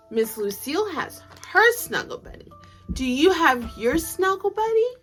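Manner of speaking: ordinary delivery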